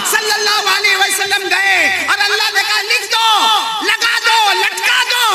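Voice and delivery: high shouted storytelling, climbing at the end; climactic preaching. Real, not acted. Preacher telling how the verse was hung, climactic. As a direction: drive the tale up to a ringing command.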